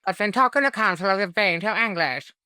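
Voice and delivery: high, loud put-on posh English accent; hammed-up English accent; bad posh English accent. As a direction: try a plummy English accent and fail. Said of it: "I can't do her accent, it's too English".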